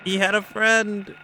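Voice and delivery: high, loud alarmed yell; alarmed shout. Real, not acted. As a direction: shout it in panic. Second enemy attacks.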